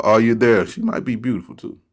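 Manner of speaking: ordinary talk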